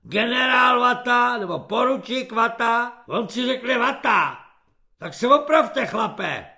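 loud sneering tirade; scornful yell. A narrator's reading. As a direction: scold the recruit loudly. Corporal berating recruit.

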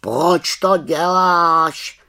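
high, loud, drawn-out raven voice; slow, loud bird-like query; questioning raven. A narrator's reading. Raven questions the little witch.

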